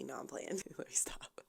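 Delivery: breathy whisper, taking the joke back; whispered take-back. Real, not acted. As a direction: drop to a whisper, only kidding. Takes back a joke about her twang.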